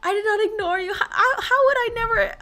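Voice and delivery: high wail building to a loud cry; anguished high-pitched pleading; distraught protest. Real, not acted. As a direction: protest tearfully, voice climbing. Distressed at seeming to ignore viewers.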